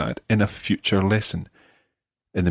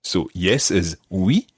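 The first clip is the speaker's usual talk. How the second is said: slow, deliberate drill voice; slow teaching; 3 semitones lower, slower than usual. Shown as a demonstration. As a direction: spell it out slowly. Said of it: "teaching drill".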